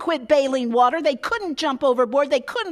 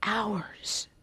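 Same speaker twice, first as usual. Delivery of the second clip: one word, whispered and falling; 3 semitones lower, 7 dB quieter than usual. Acted. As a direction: let it hang, whispered. Sermon, dwelling on the long night.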